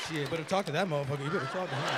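low and quick, muttered threat; low, fast mock angry brother. Angry brother grumbling at the kid. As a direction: grumble the threat under your breath.

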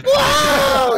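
very high loud whoa; droid's yelp. Acted. Droid fleeing toward medical bay, high yelp. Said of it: "the droid's like".

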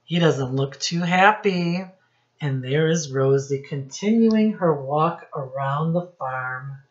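ordinary delivery, nothing marked

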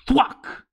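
high, sharp sound effect for an arrow hit; arrow thwack. Acted. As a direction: pop the impact noise out high. Arrow hits the dwarf.

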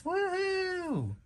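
very high woohoo; cheering for the SpongeBob anniversary, very high; excited cheer. Acted. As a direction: whoop it up with joy. Celebrating the anniversary.

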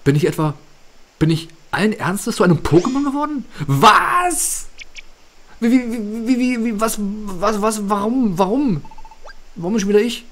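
high, loud panicked squeals of disbelief. Acted. Voicing the Pokémon hero, shocked at being Flamly again. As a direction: stammer it out in rising alarm, voice jumping up.

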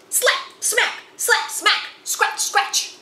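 sound effects. Acted. Swatting mosquitoes, sound words.

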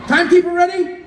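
loud call across the field. Real, not acted. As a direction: shout out to the timekeeper. Host calls to the timekeeper.

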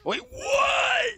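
sudden high yelp of disbelief; shocked shout. Real, not acted. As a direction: shout it in shock, pitch jumping up. Disbelief at opponent's move.

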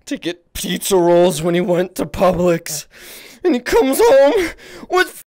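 slow rant building to a tearful shriek; hammed-up tantrum. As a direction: start whiny and explode into a wail. Ranting about dad's groceries.

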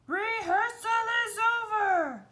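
high, loud furious crab yell; loud, high crab shout. Acted. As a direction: blow up and end rehearsal. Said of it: "the crab yelled".

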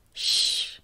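put-on whispered shh to a baby; hushing. Lily hushes fussing baby Harry with a soft whispered shh.

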